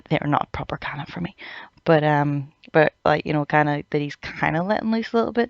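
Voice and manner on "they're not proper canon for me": half-laughing whisper of a confession; whispered aside. Real, not acted. Admitting to viewers omakes don't count, whispered. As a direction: whisper it like a guilty secret.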